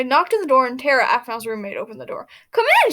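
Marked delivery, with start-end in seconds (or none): 2.5-2.9 put-on high bright welcoming girl voice; cheerful girl voice. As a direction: invite them in sweetly, way up high. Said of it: "she said with a smile".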